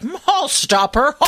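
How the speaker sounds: high, drawn-out mock politician voice; Gavin Newsom. Host mocking the governor hitting a kill switch, high drawn-out impression. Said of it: "Gavin Newsom's like".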